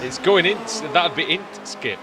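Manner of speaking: put-on playful Yorkshire accent, "Int Skip". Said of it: "in the Yorkshire accent as well".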